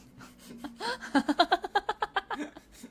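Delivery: high, loud helpless laughing; wheezing laugh. Real, not acted. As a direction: crack up helplessly. Helpless laughter after joke.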